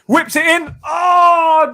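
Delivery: rising yell as the cross whips in; excited shout. Real, not acted. Fan yelling at a cross during the match, excited shout. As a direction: shout it as the chance comes. Live match reaction, big chance.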